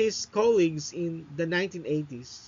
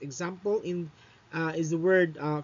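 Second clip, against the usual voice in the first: usual speaking voice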